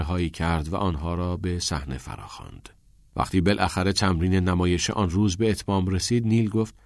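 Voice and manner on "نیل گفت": plain reading voice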